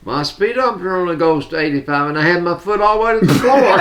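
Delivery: fast, rising mock-earnest protest, laughing at the end; eager driver. Grandpa delivering the speeding punchline to the grandchild, fast and laughing.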